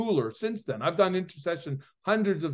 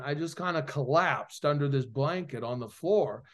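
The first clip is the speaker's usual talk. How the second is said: normal talking voice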